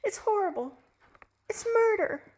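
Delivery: high horrified cry; horrified wife. Acted. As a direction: recoil in horror at him.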